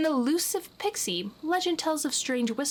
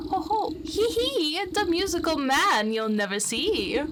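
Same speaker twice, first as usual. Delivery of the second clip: much higher, chittering sing-song taunt; mocking pixie; 5 semitones higher than usual. Unseen pixie taunting the witch, high chittering. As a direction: tease from nowhere like a sprite. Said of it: "chitters mockingly into your ear".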